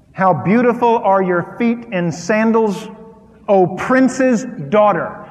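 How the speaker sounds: loud, lifted reading of the verse; preaching scripture. Acted. Scripture read aloud with force.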